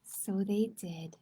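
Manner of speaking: slow, hushed whisper. A narrator's reading. Narrator closing the story softly, whispering. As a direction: hush it like a secret ending.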